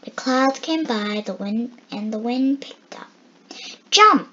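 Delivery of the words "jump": high, loud shout of jump; yelled. A narrator's reading. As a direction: yell it out to jump. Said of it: "yelled Boom".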